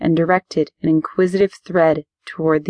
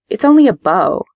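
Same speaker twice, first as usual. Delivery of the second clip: sharp, loud, higher angry retort; snapped; 4 semitones higher, 5 dB louder than usual. Acted. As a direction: snap back defensively. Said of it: "I snapped".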